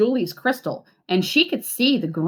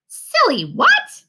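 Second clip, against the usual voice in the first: much higher, louder, slow "Silly what?"; baffled child; 6 dB louder, slower than usual. A narrator's reading. Julie mishearing her dad, much higher baffled squeak. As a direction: squeak it out in total confusion.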